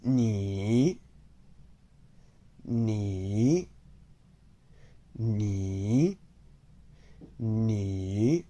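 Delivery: careful Mandarin model of "ni" with a dip; third tone. Shown as a demonstration. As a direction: draw each "ni" down, then up. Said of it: "learn how to say you".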